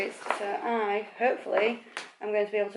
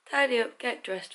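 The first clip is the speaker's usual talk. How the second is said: ordinary talk, nothing marked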